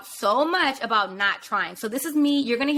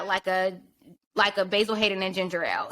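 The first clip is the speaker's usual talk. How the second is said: ordinary delivery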